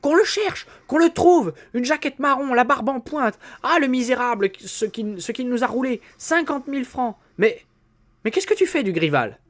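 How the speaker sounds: high, loud ranting fury; ranting wife. Acted. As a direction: keep yelling accusations at the fake inspector. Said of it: "Sa femme continuait à vociférer". (la femme vocifère, très aiguë)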